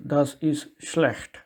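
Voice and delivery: slow, careful Das ist schlecht; slow demo. Shown as a demonstration. Teacher slowly models Das ist schlecht for learners. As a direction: lay out each word slowly. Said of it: "The word schlecht is not good".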